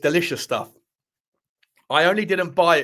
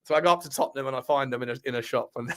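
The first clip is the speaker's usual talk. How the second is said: usual speaking voice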